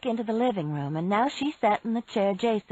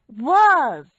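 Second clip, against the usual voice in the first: loud interjection; 6 semitones higher, 10 dB louder than usual. Acted. Frances cuts in correcting.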